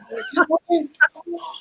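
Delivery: sharp high gasp of delight; excited gasp. Pretend. Buyer thrilled by a first iPhone, high gasp. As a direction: gasp like unboxing a new phone.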